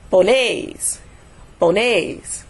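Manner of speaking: "pones" as "ponéis", weight on NÉIS; stress on last syllable. Shown as a demonstration. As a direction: lean on the final syllable of "pones". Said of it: "stress is going to be where the accent is".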